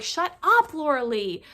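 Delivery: high, loud mock yell; retold group yell. Girls all turning on Laura Lee, mock yelling. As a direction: imitate friends yelling someone down. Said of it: "don't yell at her".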